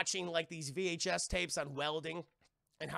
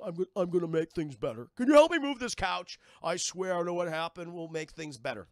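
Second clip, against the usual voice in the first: lightly imitated film-villain voice; a hint of a creepy movie killer; Buffalo Bill; 6 dB louder than usual. Man promises on a call, Buffalo Bill voice. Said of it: "Buffalo Bill from Silence of the Lambs".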